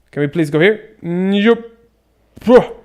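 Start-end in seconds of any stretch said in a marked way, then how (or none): 2.4-2.7 high, loud exasperated bruh. Real, not acted. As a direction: groan it in disbelief. Exasperated at game.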